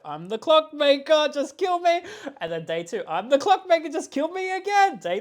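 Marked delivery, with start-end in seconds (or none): 0.0-2.0 high, loud, drawn-out retold plea; hammed-up high-pitched cry to be sacrificed; exasperated mock plea. 3.1-5.0 very high, loud comic begging; hammed-up high cry of a willing victim; exasperated mock plea. Retold second day's plea.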